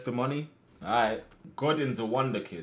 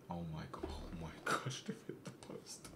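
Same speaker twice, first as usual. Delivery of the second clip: soft, breathless whispering; stunned whisper; 7 semitones lower, 15 dB quieter than usual. Real, not acted. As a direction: whisper in disbelief at the miss. Near miss on screen.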